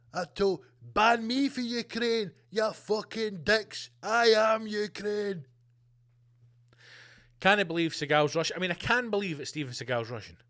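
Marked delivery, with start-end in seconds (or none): 0.1-5.5 slow Seagal voice. Pretend. Seagal emerging from tank.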